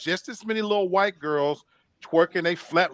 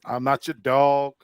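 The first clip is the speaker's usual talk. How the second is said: ordinary delivery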